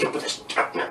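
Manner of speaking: hushed warning whisper to a friend; whispering to Greg to put out the cigarette; urgent whisper. Retold or imitated. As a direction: whisper it fast before security sees. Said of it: "I'm like".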